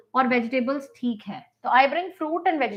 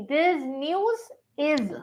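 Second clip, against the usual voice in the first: drawn out, softer correct form; very slow correction; 3 dB quieter, much slower than usual. Shown as a demonstration. Teacher stressing the corrected grammar to students. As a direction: stretch each word of the fix. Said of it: "fake नहीं".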